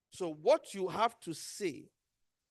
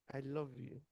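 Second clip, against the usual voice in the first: low, soft, gentle "I love you"; soft tender I love you; 7 semitones lower, 10 dB quieter than usual. Shown as a demonstration. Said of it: "Your tone".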